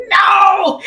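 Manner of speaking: high, imitated exasperated refusal; mock snap. Husband turning down his wife's lap dance, mock snap. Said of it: "He snaps".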